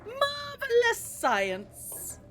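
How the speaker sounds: high, slow, theatrical proclaim; hammy mad scientist. Pretend. Theatrical exclamation.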